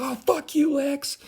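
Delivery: high-pitched group jeer; hammed-up high heckle; mock outrage. Acts out everybody's outrage at Lex.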